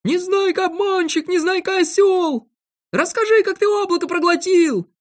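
very high, loud jeering; shrieked, stretched-out teasing; yelling at full throat. A narrator's reading. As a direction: taunt him like a bawling playground kid. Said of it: "заорал во всё горло".

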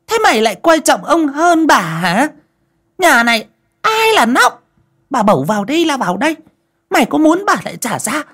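very high, loud shrill tirade; furious shrew. Acted. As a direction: threaten the servant in a rage. Mistress scolds servant angrily. (mụ chủ quát tháo giận dữ)

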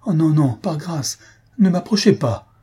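high, alarmed begging; frightened plea. A narrator's reading. Young girl backing away from the old man, alarmed plea. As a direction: plead to keep them away, with bursts of volume. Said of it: "la jeune fille s'éloignant brusquement".

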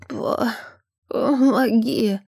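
slow, gurgling plea for help. Acted. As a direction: choke the words out slowly. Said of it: "пробулькал старик".

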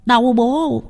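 put-on high whiny little boy; childish yelp of pain; boy's voice. A narrator's reading. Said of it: "Thằng bé vùng dậy". (giọng cậu bé kêu đau)

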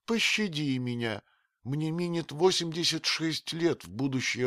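put-on terrified old woman, high, building to a scream; screaming in fear. A narrator's reading. Old nurse begging the stranger not to kill her, rising to a scream. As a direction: plead for mercy, then shriek for help. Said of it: "закричала от страха".

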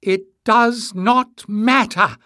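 very high, loud, slow declaration; high, nervous outburst. A narrator's reading. Emless breaking the silence before everyone, high nervous outburst. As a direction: burst out word by word, nerves showing. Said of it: "the high, nervous voice broke the silence".

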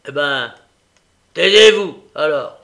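menacing order. A narrator's reading. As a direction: order silence with a loud threat. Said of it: "d'un air menaçant".

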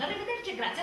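usual speaking voice

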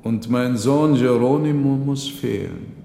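father mourning his absent son; old father lamenting. Acted. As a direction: let the old man's loss sound through. Old man laments missing son.

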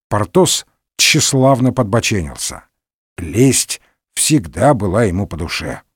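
usual speaking voice

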